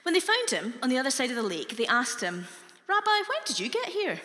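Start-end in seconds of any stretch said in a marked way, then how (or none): 2.9-4.2 much higher, strong put-on Glaswegian accent. Crowd asking Jesus in a jokey high Glaswegian accent. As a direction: play the crowd as broad Glaswegians. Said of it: "They spoke with Glaswegian accents".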